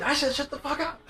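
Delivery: high-pitched, heated repeat; retold yelling. Driver answering his angry date, high mock yell. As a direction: re-enact snapping back in an argument. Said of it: "So we're yelling at each other".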